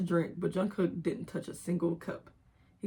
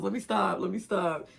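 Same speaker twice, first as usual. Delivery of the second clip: normal talking voice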